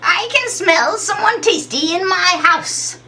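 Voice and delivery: slow, high witch voice; high squeaky voice. Acted. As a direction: croon it slowly like a hungry witch. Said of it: "you hear a high, squeaky voice".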